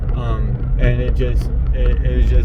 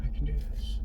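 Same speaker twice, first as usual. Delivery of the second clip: breathless whisper, much softer; whispered mantra; 15 dB quieter than usual. Real, not acted. Repeating her mantra to herself in the car, a frightened whisper. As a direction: whisper it to yourself to hold on.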